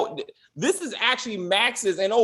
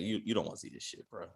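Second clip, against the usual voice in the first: hushed; 11 semitones lower, 13 dB quieter, faster than usual. Retold or imitated. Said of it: "They're like".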